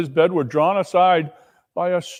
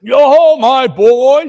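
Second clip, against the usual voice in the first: much higher, loud hearty call; jolly hail; 9 semitones higher, 11 dB louder, slower than usual. Acted. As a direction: greet the boy with gusto. Fezziwig hails Scrooge.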